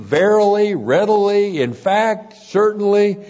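each word punched high and loud; emphatic list of synonyms; emphatic alternatives. Shown as a demonstration. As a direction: hit every synonym hard. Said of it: "it may be translated indeed".

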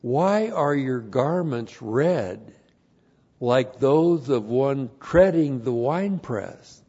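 slow, emphatic reading with stretched key words; scripture reading. Real, not acted. Preacher reading Isaiah to the congregation, solemn declamation. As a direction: declaim the verse deliberately.

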